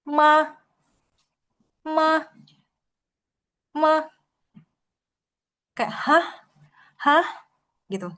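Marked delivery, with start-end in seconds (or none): 0.1-3.9 MA rising from middle to high; rising second tone. Shown as a demonstration. Teacher demonstrating tone two, MA climbing high like a question. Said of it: "nada dua tuh mirip seperti orang bingung". 5.9-7.3 HAH high and questioning; confused question tone. Shown as a demonstration. Teacher likening tone two to confusion, HAH rising in question. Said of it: "mirip seperti orang bingung".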